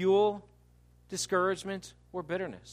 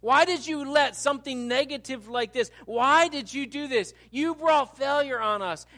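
higher, emphatic bitter complaint; upset, accusing outburst at God; angry accusation; 7 semitones higher, 6 dB louder than usual. Acted. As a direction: cry out the blame in a raised voice. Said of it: "angry statements".

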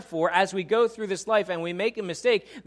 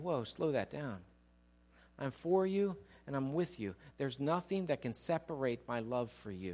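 God's gentle voice; 2 semitones lower, 8 dB quieter than usual. Acted. God voiced comforting.

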